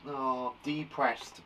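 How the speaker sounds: drawn-out, mildly glum sigh of a line; mock depressed. Shown as a demonstration. Teacher showing pupils a synonym for sad, mock depressed. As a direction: act lightly depressed, slowly.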